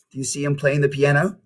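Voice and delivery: ordinary delivery, nothing marked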